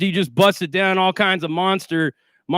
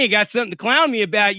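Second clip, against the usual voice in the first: normal talking voice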